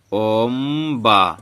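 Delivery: ओम्बा very slow, with a long m; drawn-out pronunciation. Shown as a demonstration. Teacher shows the m sound, ओम्बा stretched out. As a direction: hum through the middle of ओम्बा. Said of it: "मौ को उच्चारण में आ रहा है".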